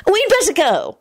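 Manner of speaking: high-pitched, anxious break-in; hurried interjection. A narrator's reading. A girl pulling Timothy away from a quarrel, hurried. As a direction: cut in to head off a fight. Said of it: "Slosh broke in quickly".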